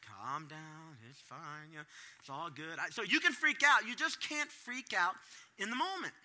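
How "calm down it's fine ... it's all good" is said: a hint of a soothing wife, softer; lightly voiced calm wife; calm reassurance. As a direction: settle him down gently. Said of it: "she's always like".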